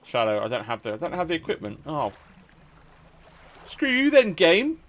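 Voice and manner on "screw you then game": mock indignation. Real, not acted. Game denies him equipment.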